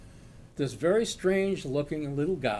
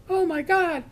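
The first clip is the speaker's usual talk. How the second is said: high, loud panicked retold scream; 13 semitones higher, 7 dB louder than usual. Bank teller screams at the robber that he has the children, panicked. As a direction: cry it out in horror. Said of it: "she starts screaming".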